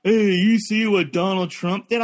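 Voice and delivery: put-on caricature of a topical comic; mocking hack comedian. Comic mimicking every comedian's Trump bit, mocking. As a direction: toss it out like a tired opener. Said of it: "every comedian was talking about".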